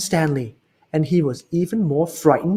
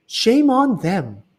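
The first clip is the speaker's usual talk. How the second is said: higher, slow firm mother's voice; indignant mother; 7 semitones higher, slower than usual. Acted. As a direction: scold the mean kids gently. Mrs Lambchop indignant.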